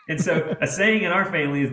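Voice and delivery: ordinary delivery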